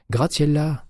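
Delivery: high-pitched cry of a name; surprised exclamation. A narrator's reading. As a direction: call out to a friend you didn't expect. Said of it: "s'écria-t-elle". (exclamation surprise, très aiguë)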